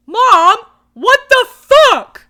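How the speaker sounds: high, loud, slow indignant shout; hammed-up furious kid; outraged yell at mom. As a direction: bellow it at mom in mock outrage. Retells video kid yelling at mom.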